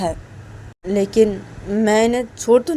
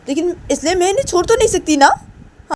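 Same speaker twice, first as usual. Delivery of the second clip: higher and faster, a touch of a stubborn daughter; insisting daughter; 6 semitones higher than usual. Acted. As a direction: rush it out, pitched up, refusing to budge. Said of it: "तो तभी उमा अपनी को बोलती कि".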